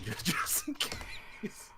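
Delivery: slow laughing whisper; whispered aside. Acted. Aside while readying spell.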